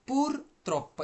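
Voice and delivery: "purtroppo" pitched high, split pur, trop-po; pronunciation model. Shown as a demonstration. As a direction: show learners "purtroppo" with a high PUR and held double p. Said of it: "এভাবে উচ্চারণ করেন". ("purtroppo" উঁচু সুরে ভেঙে উচ্চারণ)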